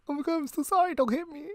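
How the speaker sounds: high, soft, pleading squeak; mock meek apology. Mocking friend begging the driver not to hit her, meek. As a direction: plead timidly in a put-on high voice. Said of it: "Yeah, like".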